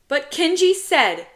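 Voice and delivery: put-on high, loud kid's objection; protesting child. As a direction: argue back like a thwarted little boy.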